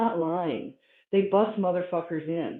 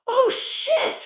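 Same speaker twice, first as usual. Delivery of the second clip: very high, loud exclamation of disgust; hitchhiker's appalled yell; 5 dB louder than usual. Acted. Hitchhiker smelling the people in the car, appalled high yell. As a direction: cry out as if hit by a stench. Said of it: "this motherfucker was going".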